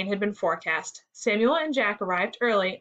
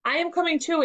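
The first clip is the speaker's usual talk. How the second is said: higher-pitched eager announcement; storybook child calling out, pitched up; announcing; 8 semitones higher than usual. A narrator's reading. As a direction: pipe up to join in. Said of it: "Amy announced".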